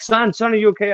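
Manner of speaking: a hint of an anxious father calling out to his son; worried dad. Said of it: "and he was like".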